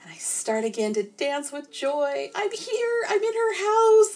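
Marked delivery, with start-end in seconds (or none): 2.4-4.1 high excited squeal; giddy high-pitched cheer; thrilled. Acted. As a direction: squeal with delight at arriving. Ghost thrilled to be inside.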